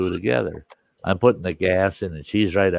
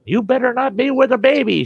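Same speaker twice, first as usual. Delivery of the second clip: high-pitched, scolding holler; mock furious mama, voice way up; imitated mother yelling; 13 semitones higher than usual. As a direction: holler a warning after the daughter. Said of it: "her mother's yelling at her".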